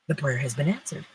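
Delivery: ordinary delivery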